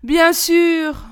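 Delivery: high, loud exasperated sigh of agreement; drawn-out, exasperated concession. A narrator's reading. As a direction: sigh it out, humouring him. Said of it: "soupira Touilly".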